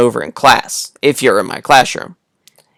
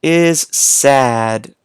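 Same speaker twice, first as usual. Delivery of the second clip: slow reading aloud; much slower than usual. Shown as a demonstration. Teacher reading to kids.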